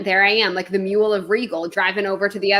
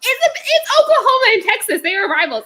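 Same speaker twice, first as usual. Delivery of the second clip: high, loud outraged lecturing; exasperated rant; 10 semitones higher, 5 dB louder than usual. Retold or imitated. Arguing rivalry with the boss, loud high exasperation. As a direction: correct her in rising disbelief. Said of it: "And I'm like".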